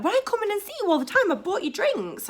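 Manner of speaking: high, whiny mock complaint; high-pitched mock indignation; mad. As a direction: imitate an aggrieved customer pleading his case. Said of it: "he'll get mad".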